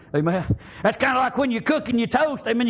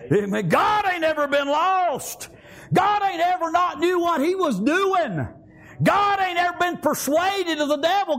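full-force preaching; 4 semitones higher than usual. Acted. Preacher shouting to the congregation, fiery preaching. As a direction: hammer each line home to the church.

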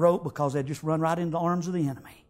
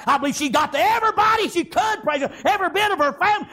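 fiery preaching; 13 semitones higher, 7 dB louder, faster than usual. Acted. Preacher building the story.

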